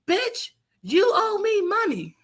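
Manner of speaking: high, slow put-on voice of a demanding friend; angry friend on phone. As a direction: drag out the demand like she's owed.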